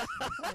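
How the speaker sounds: high, loud burst of laughter. Real, not acted. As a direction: crack up at your own impression. Cracks up at own impression.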